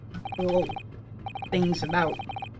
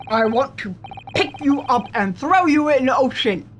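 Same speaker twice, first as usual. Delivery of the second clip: mock Russian accent. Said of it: "Russian accent".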